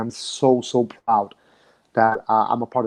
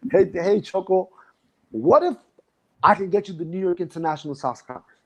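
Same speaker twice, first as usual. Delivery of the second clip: retold teasing pitch, much higher; sly pitch to Choco; 6 semitones higher than usual. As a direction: coax him playfully, voice lifted high. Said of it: "I was like".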